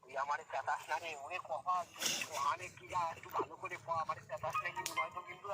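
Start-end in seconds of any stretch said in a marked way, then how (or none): none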